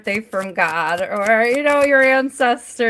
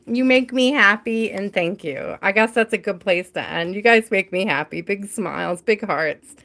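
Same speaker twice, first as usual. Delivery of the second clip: normal talking voice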